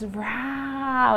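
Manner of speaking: 1 lion roar. Shown as a demonstration. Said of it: "A gente faz muito isso em terapia".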